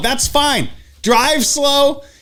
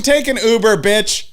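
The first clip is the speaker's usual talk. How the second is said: ordinary talk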